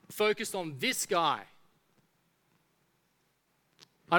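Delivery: lightly voiced grumbler talking back to God; grumbling crowd. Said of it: "They grumbled".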